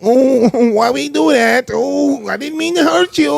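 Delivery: high, slow, whiny dopey voice; hammed-up simpleton apologizing; dumbed-down character. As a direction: moan it high like a remorseful goofball. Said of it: "he like".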